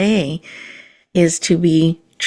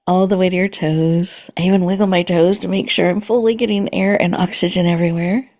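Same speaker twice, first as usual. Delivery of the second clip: guided meditation. Acted. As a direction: gently guide the listener into calm and presence.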